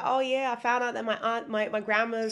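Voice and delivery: put-on a quick, clumsy stab at an American accent; bad American accent. Investor from America, in a bad American accent. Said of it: "that accent was bad".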